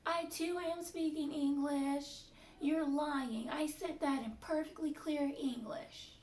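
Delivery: high, loud imitation of a scolding doctor; mock angry boss. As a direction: snap back like a stubborn boss. Doctor voiced insisting, accusing.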